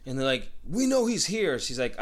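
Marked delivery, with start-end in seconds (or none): 0.7-1.6 high-pitched imitated insistence; mock accusing tone, pitched up; insistent cops. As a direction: press her like a cop, retold. Cops press the girl, retold.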